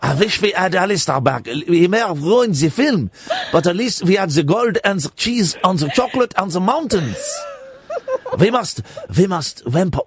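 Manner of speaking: hammed-up plotting in Swiss accent; mock-solemn Swiss villager's accent. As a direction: conspire in a silly Swiss accent. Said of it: "It's a Swiss accent".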